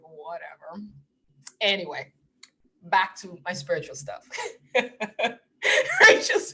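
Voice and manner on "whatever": soft low brush-off; low quiet dismissal; quiet whatever. Retold or imitated. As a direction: murmur it, done with him. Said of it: "I'm like".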